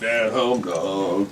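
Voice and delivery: slow low goofy voice. Pretend. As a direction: drag it out deep and dopey.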